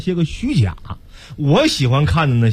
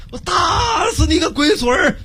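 put-on high, slow Henan-accented yell; Henan dialect yell; 13 semitones higher, slower than usual. Action hero threatening the enemy in Henan dialect, yelling. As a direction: bellow it in thick Henan dialect. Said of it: "石泰龙端着枪".

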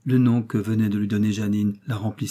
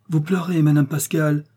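dismayed concern; 6 semitones higher, 4 dB louder than usual. Acted. Finds the old lady crying.

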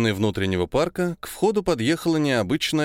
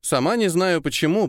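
ordinary delivery, nothing marked

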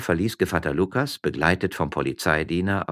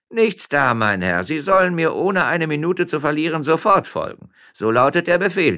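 loud, high-pitched officer's order; commanding policeman; 12 semitones higher, 8 dB louder than usual. Acted. Policeman orders miller to follow, commanding. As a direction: lay down the order forcefully.

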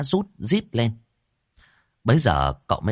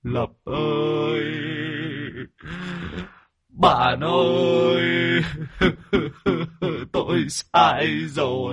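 slow, high, drawn-out acted crying; sobbing wail; 9 semitones higher, much slower than usual. Possessed man calls to his son in remorse, sobbing. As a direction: bawl out each word, stretching it. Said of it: "ôm mặt khóc tu tu".

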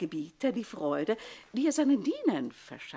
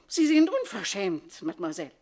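indignant outburst; 6 dB louder than usual. Acted. Insulted older woman to Susanne, indignant outburst. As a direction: snap back, stung.

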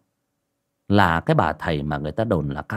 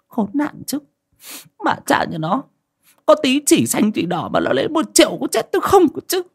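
much higher, fretful grumbling curse. Acted. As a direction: grumble bitterly over the lost money. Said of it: "bà cứ lầu bầu chửi". (lầm bầm chửi, giọng cao hẳn, cay cú)

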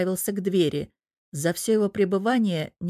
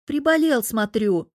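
mocking; 5 semitones higher, 4 dB louder than usual. Huntsman mocks the patient.

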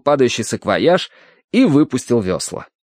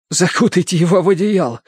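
high, strained instruction; shivering order; 7 semitones higher than usual. A narrator's reading. Terence orders the dog wrapped up, strained urgency. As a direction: give the order from cold water. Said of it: "велел Терренс".

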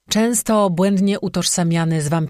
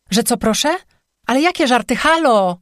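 high-pitched, affronted phone caller; incredulous, agitated outburst into the receiver; indignant disbelief; 7 semitones higher, 4 dB louder than usual. Acted. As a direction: protest in raised pitch, ending on a calling hello.

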